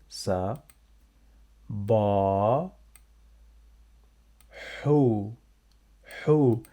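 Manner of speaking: "Ba", "Hu" drawn out, long pauses between; syllable by syllable. Shown as a demonstration. Teacher showing learners the syllables, "Ba" and "Hu" each alone. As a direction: sound out "Ba" and "Hu" one at a time. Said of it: "Let's see the syllables".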